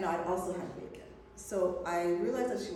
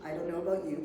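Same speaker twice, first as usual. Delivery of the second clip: normal talking voice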